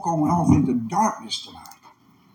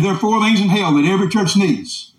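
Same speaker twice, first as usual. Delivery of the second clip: higher-pitched preacher's proclamation; emphatic repeat; 4 semitones higher, 4 dB louder than usual. Retold or imitated. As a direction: proclaim it to the whole church. Repeats saying after aside for emphasis.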